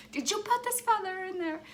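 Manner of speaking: high, loud, rattled question; alarmed demand. Retold or imitated. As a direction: accuse him in a panic, voice jumping up. Confronting husband about the feather.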